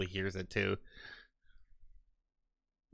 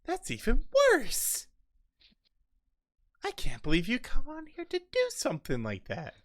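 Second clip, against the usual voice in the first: high indignant squeak. Acted. Dwarf berating the robber, high squeaky indignation.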